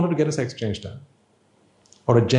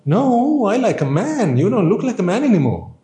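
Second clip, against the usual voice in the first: indignant wife; 8 semitones higher than usual. Acted. Wife rejects her braided husband in a raised, indignant voice. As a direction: act the offended wife, pitch up and pushing.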